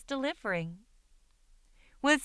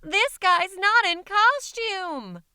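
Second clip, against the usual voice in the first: much higher, louder, slow frightened cry; terrified shriek; 10 semitones higher, 6 dB louder, slower than usual. Acted. Rebecca screaming to the others in fright, high and loud. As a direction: cry out in horror. Child terrified, discovers real monster.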